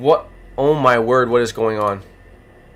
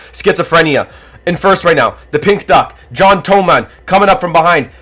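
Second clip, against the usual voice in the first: louder, quick play-by-play of the duck race; live race commentary; 4 semitones higher, 4 dB louder than usual. Acted. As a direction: call the race like a sportscaster.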